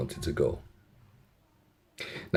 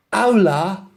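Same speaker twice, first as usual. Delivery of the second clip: put-on high Spanish hello in a London accent; London-accented Spanglish; 8 semitones higher, 3 dB louder than usual. London girl trying Spanish on the driver, London accent. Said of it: "in the best Spanglish".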